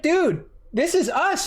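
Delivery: high, slow mock tech voice; sarcastic impression of the repair guy. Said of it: "they're just like".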